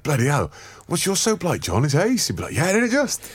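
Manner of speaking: high, swooping mock Welsh lilt; Welsh-sounding banter. Teammates chatting about soap in the shower, mock Welsh. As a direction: sing it up high like a Welsh lad. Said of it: "Was he Welsh?".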